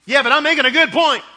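loud, high, heated insistence; a husband arguing with his wife in the wrong tone. Retold or imitated. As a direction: push your point too hard. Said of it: "All she hears is how I'm saying it".

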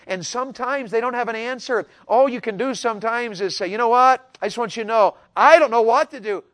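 loud, high urging; urgent exhortation. Acted. Pastor urging kids to share, loud and high. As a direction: call the kids to talk.

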